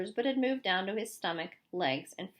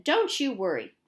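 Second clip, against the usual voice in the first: usual speaking voice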